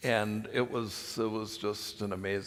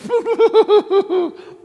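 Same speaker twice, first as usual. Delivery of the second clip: very high, loud rapid cackle; loud imitated laugh; 16 dB louder than usual. Somebody at the campfire about to name him, loud high laugh. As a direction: burst into a high staccato laugh. Mimics the person naming him Lumpy.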